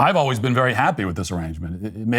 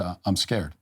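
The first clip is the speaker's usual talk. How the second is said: soft, low, mock-tearful confession; mock scared son; 5 semitones lower, 7 dB quieter than usual. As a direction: imitate a misty-eyed child, hushed and low. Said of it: "his eyes got misty".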